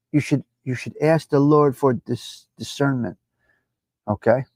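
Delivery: ordinary delivery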